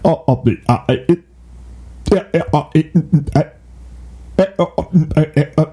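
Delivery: mock halting robot-like uhs; robotic stammer. Host imitates her halting delivery, robotic and stalling. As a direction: stutter flatly like a broken machine. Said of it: "it's literally robotic like that".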